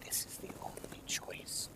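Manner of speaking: put-on faint whispered voice of a girl from memory; ghostly girl's whisper. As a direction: breathe it like a half-remembered voice. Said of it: "you hear that girl's voice".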